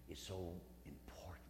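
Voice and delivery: sudden breathy whisper on the key words; whispered emphasis. Real, not acted. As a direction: drop to a whisper to make it land. Preacher drops to a whisper for emphasis.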